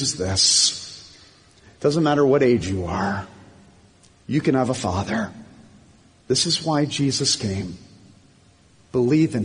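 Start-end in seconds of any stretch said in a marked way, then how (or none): none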